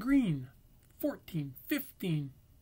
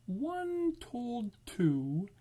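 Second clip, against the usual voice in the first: ordinary delivery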